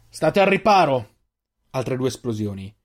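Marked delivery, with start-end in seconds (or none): 0.1-1.1 high, drawn-out warning call; shouted warning. A narrator's reading. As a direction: call to stay under cover. Warning during explosions. (avvertimento gridato)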